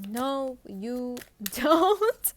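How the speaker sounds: slow no, breaking into laughter; laughing refusal. Pretend. Reader playing a Virgo, laughing as she refuses. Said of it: "And you're just like".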